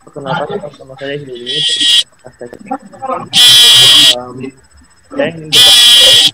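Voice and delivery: faint, distant mic; speaking far from the microphone; phone held away. Real, not acted. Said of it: "Kurang keras ya".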